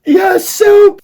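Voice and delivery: very high, loud squeal; eager rats agreeing. A narrator's reading. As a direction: shriek agreement like a mob of rats. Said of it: "the others agreed".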